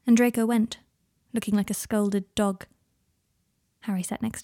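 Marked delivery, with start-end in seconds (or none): none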